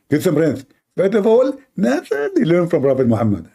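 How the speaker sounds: squeaky mock lecturer; mocking apologist. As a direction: play the know-it-all defender, voice pushed up.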